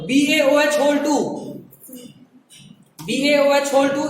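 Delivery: slow, spelled-out dictation of बीएओएच whole to; slow classroom dictation. Shown as a demonstration. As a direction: read the formula slowly so they can write it. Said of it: "वहां जहाँ space है वहां लिखो".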